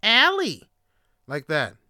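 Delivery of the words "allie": "Allie" said high and loud; mock-pronounce "Allie", loud and raised in pitch; mocked mispronunciation. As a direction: imitate outsiders calling out "Allie". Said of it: "those people that pronounce my name".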